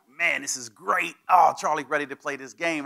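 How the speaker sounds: gleeful, egging him on; players hyped. Retold or imitated. Teammates cheering that Charlie is fired up, mock delighted.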